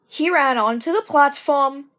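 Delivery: ordinary delivery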